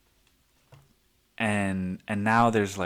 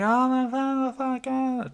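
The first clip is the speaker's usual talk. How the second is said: very high startled cry of alarm; shocked yelp; 14 semitones higher than usual. Retold or imitated. Bystanders freaking out as the guy falls, high shocked yelp. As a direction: yelp like the whole room just jumped. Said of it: "And everybody's like".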